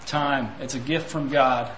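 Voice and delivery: ordinary delivery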